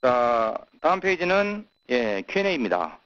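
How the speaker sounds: ordinary delivery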